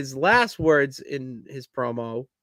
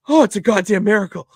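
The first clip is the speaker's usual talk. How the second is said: fast, louder mock announcer shout; commentator exclamation; 5 semitones higher, 8 dB louder, faster than usual. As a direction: lightly imitate a stunned commentator. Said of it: "you can hear Taz over commentary go".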